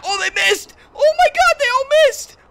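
very high, loud disbelief; elated shout. Real, not acted. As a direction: yell in amazement at the escape. Narrow escape from attackers.

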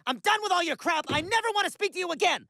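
high, heated tirade at a friend; angry break-up. Acted. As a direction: end the friendship in a fury.

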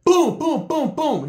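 high, punchy fight sound effects; mimicked punches. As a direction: voice each blow landing. Imitates punches landing in fight.

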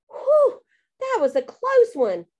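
big high-pitched WHEW of relief; very relieved. A narrator's reading. Kid hiding from witch after danger passes, relieved. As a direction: let out a loud relieved whew. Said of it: "very relieved".

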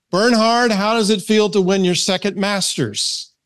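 excited field reporter, pitched high; high-pitched TV sports reporter; Jim Nantz. Acted. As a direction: put the winner's question in a high, eager interview voice. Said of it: "who was the reporter on the field".

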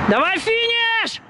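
high, slow yell toward the finish; drawn-out cheering shout. Real, not acted. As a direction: stretch the call out to carry across the track. Coach cheering runner near finish.

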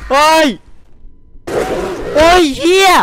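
high, loud scared shout; startled yell. Real, not acted. Streamer jumping at a scare, startled yell. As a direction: yelp in sudden fright.

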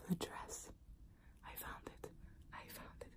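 breathless hushed whisper of delight. Retold or imitated. Bride whispering to herself after finding the perfect dress. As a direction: hush it like a precious secret.

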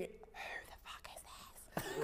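hushed, breathless whisper; whispered inner thought. Retold or imitated. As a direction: whisper it like a private aside. Imagined caller's inner thought.

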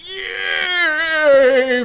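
high, loud cry of praise; rapturous holy outburst; fervent shout. Acted. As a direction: proclaim it in ecstatic zeal. Ecstatic preacher praising lord.

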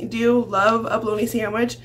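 normal talking voice

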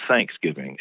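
"Thanksgiving" as THANKS-giving, first syllable strongest; Southern initial stress. Shown as a demonstration. Linguist showing Southern habit, first syllable of Thanksgiving stressed. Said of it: "more stress on most of the initial syllables".

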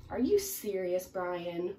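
ordinary delivery, nothing marked

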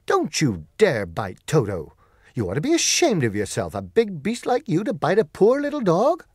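loud scolding, rising high at the end; crying out angrily. Acted. Dorothy scolds the lion for attacking Toto, loud and angry. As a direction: tell off a bully, loud and indignant. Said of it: "while she cried out".

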